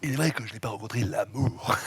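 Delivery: very low, deep put-on politician voice; Chirac voice. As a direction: drop the voice deep and grave like an old statesman. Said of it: "Fais, fais un petit peu de Chirac".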